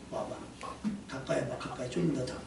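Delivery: low, very soft coaxing; quietly beckoning. Acted. Jesus calling Peter over to him, quietly beckoning. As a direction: gently call someone closer, barely voiced.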